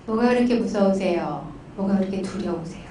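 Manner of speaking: low, softened, tender probing; gentle counseling question. Retold or imitated. Counselor coaxing pastor to name his fear, low and tender.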